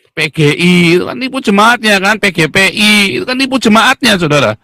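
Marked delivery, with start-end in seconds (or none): none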